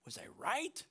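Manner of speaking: John the Baptist in prison, doubting; doubting prisoner. Retold or imitated. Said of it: "he's like".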